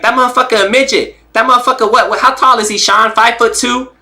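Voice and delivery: high-pitched incredulous boxer impression; Rolly. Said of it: "the Rolly impersonation is A one".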